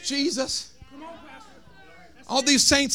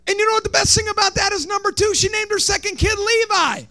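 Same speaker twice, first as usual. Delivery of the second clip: raised, ringing sermon cry; high preaching climax; 7 semitones higher than usual. Acted. Pastor preaching to the congregation, high ringing cry. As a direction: lift it up and hold it.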